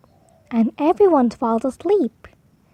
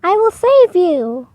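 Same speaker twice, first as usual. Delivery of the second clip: lady's voice; 5 semitones higher, 5 dB louder than usual. A narrator's reading. Lady offers rescue.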